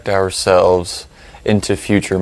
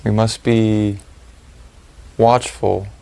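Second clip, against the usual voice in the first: calm, spacious delivery, letting each phrase settle; guided meditation; 8 dB quieter than usual. Real, not acted. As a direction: lead them through the breathing, unhurried and even.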